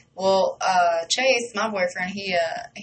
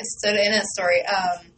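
usual speaking voice

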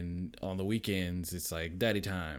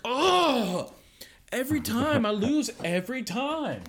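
much higher, louder mock sob; mock crying; 6 dB louder than usual. Kid after losing, mock crying wail. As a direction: wail over losing again. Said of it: "and they're like".

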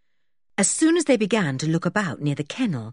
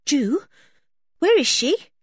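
high, louder, frightened question; sudden panic; 9 semitones higher, 4 dB louder than usual. Acted. Anne turning to Julian in sudden panic, frightened. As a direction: ask it with rising alarm. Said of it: "in sudden panic".

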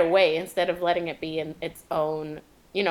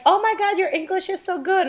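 high, gushing mock praise; mock interviewer; 11 semitones higher than usual. Mocking the interviewer praising his English, high gushing voice. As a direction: coo it like a clueless fan.

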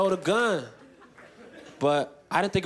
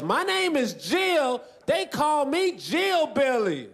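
high, slow Southern country drawl; thick Southern country accent; 8 semitones higher, much slower than usual. Retold or imitated. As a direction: stretch the vowels high, hammed-up Southern nurse. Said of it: "She was so country".

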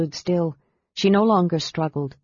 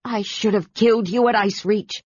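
higher-pitched, seething threat; furious regret, voice raised in pitch; angry raving; 4 semitones higher than usual. A narrator's reading. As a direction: spit it at an enemy. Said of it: "undisturbed by Kit's ravings".

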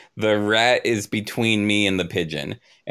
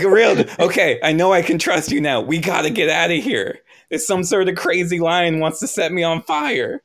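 laughing urgency; 7 semitones higher than usual. Acted. Viper urging partner to flee, high laughing urgency. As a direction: rush the escape plan while laughing. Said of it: "And I'm like".

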